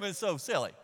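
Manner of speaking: ordinary delivery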